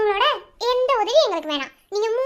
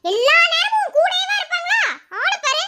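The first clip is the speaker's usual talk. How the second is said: indignant retort; 8 semitones higher than usual. Acted. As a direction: pitch jumps up in annoyance. Indignant retort to suspicious questioning. (கோபமான எதிர்க்கேள்வி)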